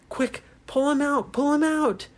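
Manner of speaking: high urgent call; young captain urging the others to pull. Acted. Ryan urges rescue again.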